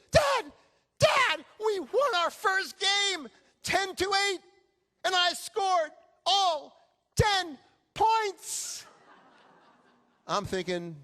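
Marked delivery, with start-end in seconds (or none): 0.1-8.8 high, loud, slow shouted excitement; thrilled kid. Retold or imitated. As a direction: yell it like a kid who just won his first game. Thrilled son reports first win.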